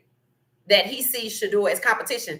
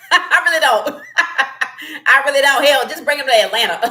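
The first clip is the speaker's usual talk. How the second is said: loud, high, laughing delivery; laughing while talking; 6 semitones higher, 8 dB louder than usual. Real, not acted. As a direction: crack up between phrases. Host cracking up at own opinion.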